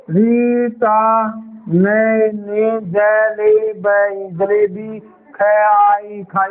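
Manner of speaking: very slow, words stretched and repeated; halting stumbling reading. Shown as a demonstration. Teacher mimics a child reading badly, halting. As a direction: stumble like a struggling reader. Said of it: "ऐसे नहीं पढ़ना".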